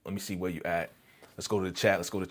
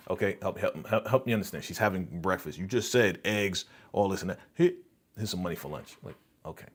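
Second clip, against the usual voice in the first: usual speaking voice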